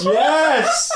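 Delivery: squealing laughing yes; excited. Real, not acted. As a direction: cheer it with a laugh. Celebrating the great idea.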